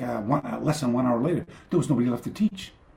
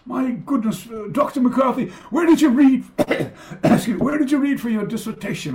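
high, polite academic voice; mock posh student; 12 semitones higher, 5 dB louder than usual. A student asking the teacher, in a high prim voice. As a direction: ask with prim, raised pitch like a keen student.

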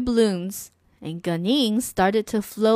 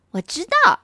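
ordinary delivery

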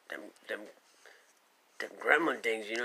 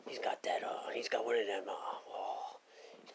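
whisper; 4 semitones lower, 7 dB quieter than usual. Real, not acted. Whispered aside in creature story.

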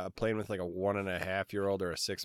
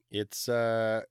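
ordinary delivery

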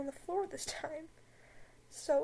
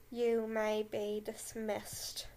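slow, lower cold dismissal; Snape voice; 3 semitones lower, much slower than usual. Acted. Snape dismissing the class, slow lower drawl. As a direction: let each word drop slowly, icy. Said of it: "Snape concluded".

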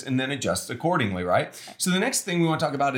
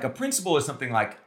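ordinary talk, nothing marked

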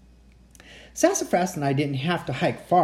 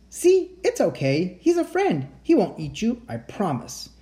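higher, gentle creature reassuring friends; reassuring monster voice; 6 semitones higher than usual. Acted.

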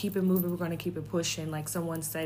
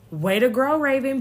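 loud self-cheer; 8 semitones higher, 10 dB louder than usual. Real, not acted. Cheering herself on.

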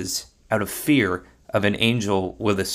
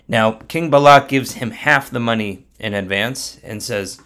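ordinary delivery, nothing marked

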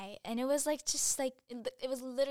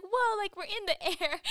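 put-on amazed kid; 9 semitones higher, 8 dB louder than usual. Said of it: "He's like".

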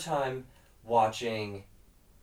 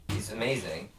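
usual speaking voice

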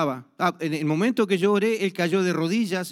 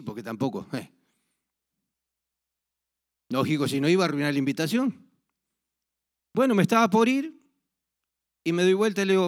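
normal talking voice

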